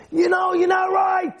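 imitated angry shout, pitched high. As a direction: act out someone flipping out. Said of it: "the guy got angry".

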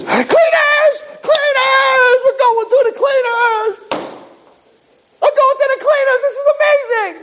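very high falsetto shouts of joy, slow on the middle line; ecstatic squeaky suit. Acted. The suit thrilled to go to the cleaners, in a squeaky joyful shout. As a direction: squeal with delight.

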